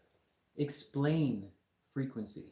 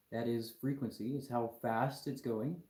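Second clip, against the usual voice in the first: ordinary delivery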